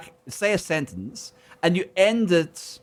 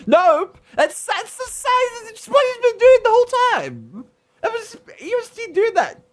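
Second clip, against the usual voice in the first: amused squeal; 3 dB louder than usual. Real, not acted. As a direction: squeak it out in disbelief. Laughing at Cockney tag habit.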